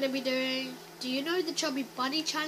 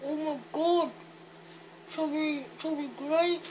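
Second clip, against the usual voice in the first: cheeks stuffed full; slower than usual. Real, not acted. More grapes stuffed in.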